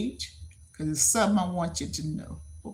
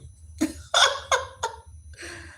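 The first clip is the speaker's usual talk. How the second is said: high laugh. Real, not acted. Streamer amused at a chat question, high laugh. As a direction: burst of high-pitched laughter.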